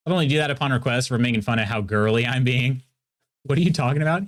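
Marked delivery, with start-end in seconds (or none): none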